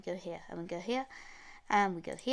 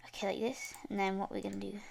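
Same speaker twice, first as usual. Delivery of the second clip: ordinary talk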